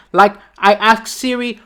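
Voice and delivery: normal talking voice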